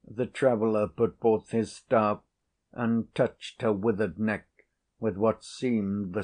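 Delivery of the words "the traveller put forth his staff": plain reading voice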